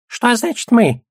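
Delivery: high-pitched, indignant goblin voice. A narrator's reading. Goblin objecting to being counted in, indignant. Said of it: "возмутился гоблин".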